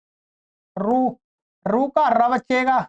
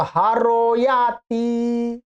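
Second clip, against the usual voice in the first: drawn out slowly; much slower than usual. Shown as a demonstration. Teacher spells out sandhi result.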